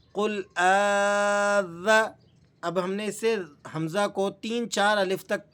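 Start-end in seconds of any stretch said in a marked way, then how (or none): 0.1-2.1 very slow joining. Shown as a demonstration. Teacher joining the spelled syllables, very slow and drawn out. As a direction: join قل آ ذا very slowly, stretching آ. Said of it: "پہلے آپ اس کے ہجے سنیں".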